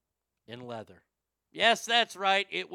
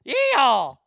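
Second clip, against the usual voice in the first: loud high Texan yeehaw; laughing mock cowgirl whoop; Southern whoop; 9 semitones higher, 14 dB louder than usual. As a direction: holler it like a rodeo cowgirl. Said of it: "she does indeed talk like that".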